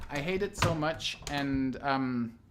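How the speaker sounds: usual speaking voice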